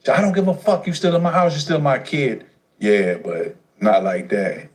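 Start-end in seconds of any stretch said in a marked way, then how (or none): 0.1-2.5 mother's retort. Retold or imitated. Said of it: "mother replying".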